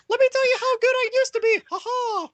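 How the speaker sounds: very high, loud, fast squeaky parody with laughs; Mickey Mouse. Acted. Parodying an executive bragging about the past, squeaky mouse. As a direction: boast and cackle like a cartoon mouse. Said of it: "I'm Mickey Mouse".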